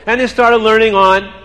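plain reading voice

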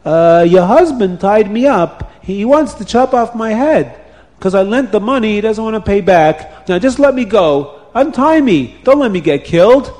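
high, loud, urgent plea; desperate pleading. A narrator's reading. Tied-up man begging the wife to untie him, desperate.

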